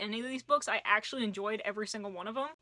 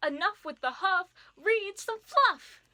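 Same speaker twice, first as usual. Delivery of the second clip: much higher, louder and slow, a chanted rhyme; fairy command; 9 semitones higher, 5 dB louder, slower than usual. Acted. Fairy ordering the grumpy reader to read romance, high chanted spell. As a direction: proclaim it like a magic spell.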